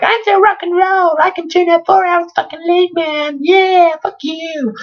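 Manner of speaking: very high, squeaky mocking impression; squeaky would-be rocker boasting. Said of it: "I imagine he speaks more like".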